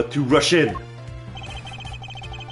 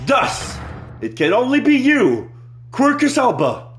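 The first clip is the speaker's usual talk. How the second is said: dramatic accusation; 10 semitones higher than usual. Acted. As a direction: point and accuse.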